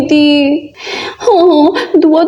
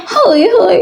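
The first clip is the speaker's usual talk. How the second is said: ordinary talk, nothing marked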